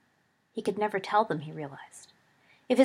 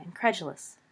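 ordinary delivery

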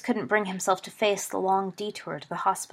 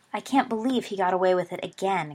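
ordinary delivery

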